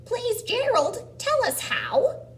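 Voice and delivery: very high, loud, slow begging; pleading onlooker. Acted. Animals want Gerald's secret, pleading loudly. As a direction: beg in a high character voice.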